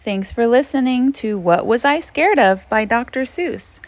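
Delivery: ordinary delivery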